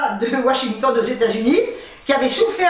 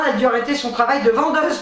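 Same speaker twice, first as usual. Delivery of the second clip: normal talking voice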